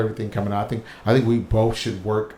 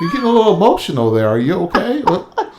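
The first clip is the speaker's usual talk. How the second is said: higher, louder faux-caring questions; mock concern; 10 semitones higher, 4 dB louder than usual. Partner feigning concern to wind spouse up, mock sympathy. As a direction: needle them with fake sympathy.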